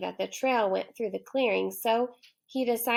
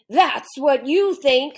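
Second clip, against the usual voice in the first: loud, slow lion demand, pitched up; gruff lion voice; 4 semitones higher, 10 dB louder, slower than usual. Acted. Lion challenging the boy, loud slow demand. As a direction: menace the boy.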